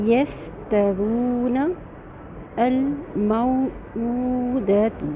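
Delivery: یستوون الموعودۃ with vowels stretched; slow recitation. Shown as a demonstration. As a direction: draw each word out slowly. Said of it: "ہم مشق کر لیں گے". (آہستہ تلاوت)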